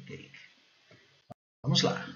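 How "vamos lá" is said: normal talking voice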